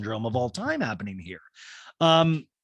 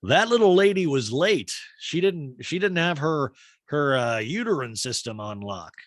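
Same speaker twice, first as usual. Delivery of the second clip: higher, hammed-up pompous dude; mock male voice; 6 semitones higher than usual. Host mocking the male view of a pregnancy scare, hammed-up. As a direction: lampoon a smug guy talking down about a woman. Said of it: "a very male thing to me".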